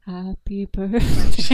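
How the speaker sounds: breathy slow whisper, sultry; Marilyn Monroe whisper. Pretend. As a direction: whisper it slowly, sultry, with a giggle. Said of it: "Marilyn Monroe".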